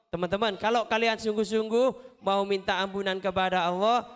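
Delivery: ordinary delivery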